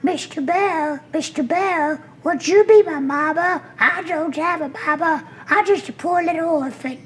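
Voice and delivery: very high, squeaky orphan duckling pleading; little duck voice. Shown as a demonstration. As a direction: beg like a tiny lost duckling. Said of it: "out of my little eleven-year-old mouth came".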